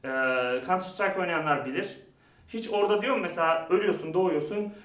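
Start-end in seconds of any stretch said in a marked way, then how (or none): none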